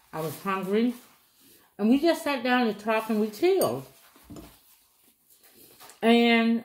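talking with mouth full; eating during speech; chewing while talking. Real, not acted. Seen 4: Bites pastry at 1.1, mouth keeps chewing while talking after.

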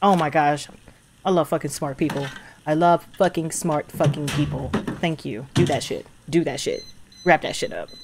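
low, soft, savouring approval; quietly impressed, voice dropped low; hushed admiration. Real, not acted. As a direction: murmur appreciatively under your breath. Savouring smart characters' move.

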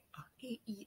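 breathy whisper of the letters. Real, not acted. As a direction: whisper them like a secret.

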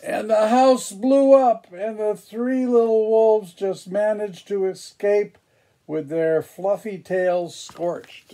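high, loud burst of excitement; dramatic excitement. A narrator's reading. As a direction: announce the blast with drama. Explosion narrated dramatically.

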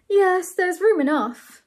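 a mild storybook sigh. A narrator's reading. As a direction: let it out on a weary sigh. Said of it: "sighed Bernard".

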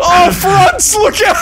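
high frantic yell; shouting warning. Real, not acted. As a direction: scream the warning. Warning teammate.

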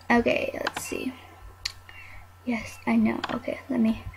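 hushed aside, breath only; whisper. Real, not acted. Talking aside to someone off-camera, whispering. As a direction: drop to a whisper while stepping off-mic. Steps away mid-recording, whispering.

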